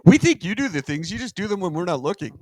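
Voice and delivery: high-pitched mock accusation; hammed-up suspicious crowd, pitched high; accusing crowd. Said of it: "And like".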